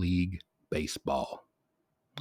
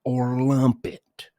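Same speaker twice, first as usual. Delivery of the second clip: slow, louder and higher finishing a rant; imitating dad's rant; 5 semitones higher, 4 dB louder, slower than usual. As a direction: hammer it home like a scolding Southern dad. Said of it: "he used to love to say".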